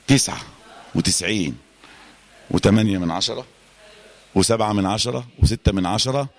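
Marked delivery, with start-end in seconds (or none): none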